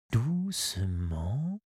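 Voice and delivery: drawn out very slowly and softly, "doucement"; slow soft echo. A narrator's reading. Narrator easing the cannons down, slow and soft. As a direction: stretch "doucement" gently to match the crane. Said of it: "déposés doucement".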